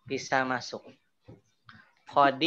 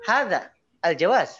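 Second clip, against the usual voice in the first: "hadza" lifted, then a break before "al jawaz"; emphatic "hadza", pause, "al jawaz"; stress and pause; 5 semitones higher, 6 dB louder than usual. Shown as a demonstration. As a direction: set "hadza" apart from "al jawaz" with intonation. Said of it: "kalau pakai intonasi".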